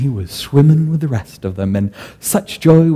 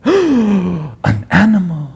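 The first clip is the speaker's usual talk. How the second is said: slow, higher amazed question; 6 semitones higher, slower than usual. Acted. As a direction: marvel at the idea. Caliph amazed at the spell.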